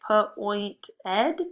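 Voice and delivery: "P, oint, ed" as separate sound chunks with gaps; sounded out in parts. Shown as a demonstration. Teacher trying out a word for pupils, sounding out "P, oint, ed" piece by piece. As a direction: sound out P, then oint, then ed, each alone. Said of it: "Let's try it".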